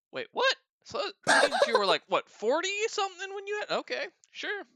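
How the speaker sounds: very high, incredulous, with laughter; baffled disbelief. Retold or imitated. Younger lifter working out old man's fatherhood, baffled disbelief. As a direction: squeak out the confusion, then give in.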